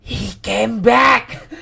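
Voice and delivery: slow, high, loud shout. Real, not acted. Streamer shouting at a shock, high, slow and loud. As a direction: bellow it out slowly.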